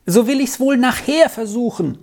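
high, lively call; bright-pitched promise called over the shoulder; calling back. A narrator's reading. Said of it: "rief Dora zurück".

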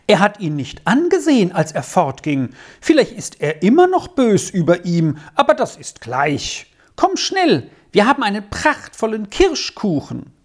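put-on high, excitable child character leaping between thoughts; breathless-with-news Squirrel. Squirrel reports to Papa and urges him along, excited.

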